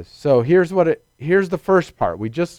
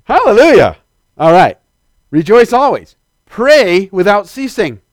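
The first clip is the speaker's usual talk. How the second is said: louder and higher, full preacher fire; fervent preaching; 6 semitones higher, 8 dB louder than usual. Real, not acted. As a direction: ring it out to the congregation. Preacher rising to fervor.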